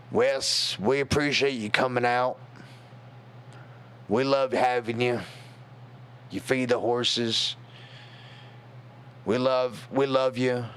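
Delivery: lightly voiced gruff Texan uncle; a touch of gruff Texas accent, imitating an older relative. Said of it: "In his real gruff Texan accent".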